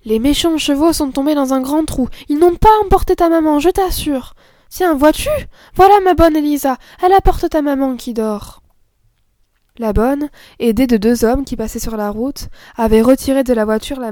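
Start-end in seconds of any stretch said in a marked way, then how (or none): 0.1-8.6 put-on soothing girl. A narrator's reading. Camille soothes the child.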